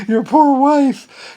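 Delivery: high, loud, slow drawn-out sympathy; mock pity. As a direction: pity her theatrically. Repeats mock pity for wife.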